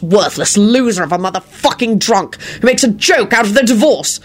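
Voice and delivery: heated tirade climbing in pitch; enraged. A narrator's reading. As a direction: berate them all, building to a high demand. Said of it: "Lupin sprang up in a rage".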